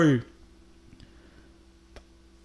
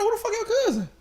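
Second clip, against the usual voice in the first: much higher, baffled retort; sudden high-pitched disbelief; confused outburst; faster than usual. Retold or imitated. Retold confused reply to friend.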